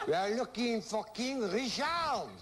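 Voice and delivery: slow, hammed-up French accent on Richard. Shown as a demonstration. Host teasing a contestant in a mock French accent. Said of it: "I'll say it with a French accent".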